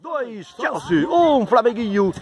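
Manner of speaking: high, drawn-out sportscaster call; stretched score call. Real, not acted. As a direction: draw out each team and number. Commentator calls out the score.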